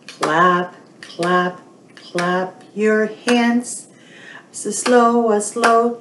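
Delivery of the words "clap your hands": ordinary talk, nothing marked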